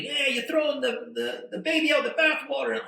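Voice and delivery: yelling at the official, hammed up; retold screaming. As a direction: crowd shouting at the hotel official, mock yell. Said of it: "everyone's screaming".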